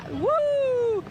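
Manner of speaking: high whooping cheer; excited woo. Real, not acted. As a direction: let out a happy whoop. Cheering during dance.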